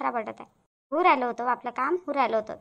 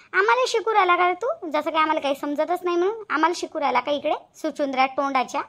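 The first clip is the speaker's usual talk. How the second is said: angry; 2 semitones higher, 4 dB louder than usual. Acted. Shantabai tells off the young man for interfering, angry. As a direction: confront him in raised, indignant voice. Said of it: "एवढ्या रागाने बोलू नका".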